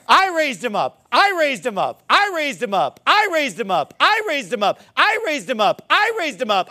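mock boasting. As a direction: hammer out the same boast over and over. Mock boast repeated during illustration.

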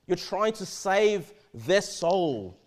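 You're trying to save their soul, slowed right down with trying leaned on hard; slow stress on trying. Real, not acted. Preacher to congregation, stressing trying slowly. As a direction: drag out trying so the point lands.

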